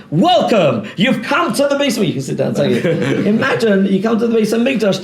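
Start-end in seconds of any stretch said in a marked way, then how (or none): 0.1-2.1 high, bright host's greeting, lightly voiced; pitched-up mock welcome; eager welcoming priest. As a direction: greet the visitor like an inspired priest. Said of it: "you're supposed to say".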